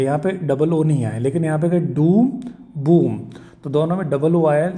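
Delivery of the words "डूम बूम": डूम, बूम with a drawn-out "oo"; long "oo" in डूम, बूम; long vowel demo. Shown as a demonstration. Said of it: "बड़ा ऊ का sound".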